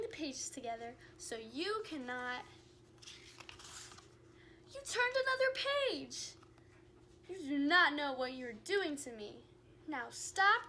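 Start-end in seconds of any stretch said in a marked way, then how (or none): 4.7-6.3 alarmed. Acted. Grover crying out at the reader turning the page. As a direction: gasp at the turned page.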